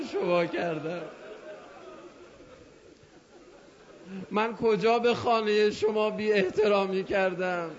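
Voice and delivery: rising, impassioned sermon lament; preaching plea. Acted. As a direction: plead with the Imam from the pulpit. Preacher's emotional plea to Imam.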